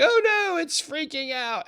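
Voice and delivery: high, hammed-up "oh no" of a scared trader; mock panic. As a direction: mimic a nervous trader freaking out.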